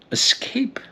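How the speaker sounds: breathy high whisper on one word; dramatic whisper. Acted. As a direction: let the word escape as a hushed gasp. Dramatic pause, no escape.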